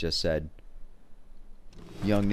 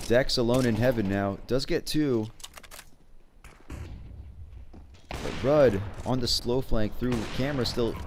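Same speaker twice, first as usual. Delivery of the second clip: higher and hyped, building to a peak; excited play-by-play; 6 semitones higher than usual. Acted. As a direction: hype up the clutch plays. Play-by-play of a clutch round.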